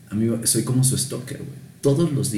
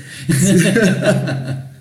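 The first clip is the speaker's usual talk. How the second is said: loud laugh; 9 semitones higher, 5 dB louder than usual. Real, not acted. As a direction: burst of high laughter. Laughing at his own weak hands.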